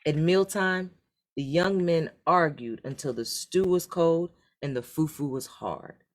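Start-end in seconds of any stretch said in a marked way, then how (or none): none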